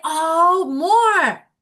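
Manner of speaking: a bright, loud student answer; excited answer. Retold or imitated. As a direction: call out the answer eagerly.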